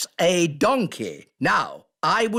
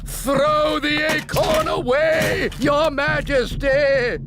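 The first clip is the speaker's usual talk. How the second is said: urgent warning shout; 6 semitones higher than usual. Acted. Squirrel-disguised elf shouting a warning to the Queen. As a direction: shout the warning across to her.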